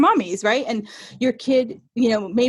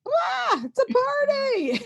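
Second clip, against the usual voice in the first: high, slow whoop of vacation joy; party mood; much slower than usual. Retold or imitated. Imitating herself on vacation, a slow high party whoop. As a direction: let loose like you just landed on holiday.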